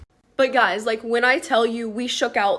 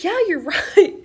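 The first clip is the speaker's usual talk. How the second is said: laughing; 7 semitones higher, faster than usual. Retold or imitated.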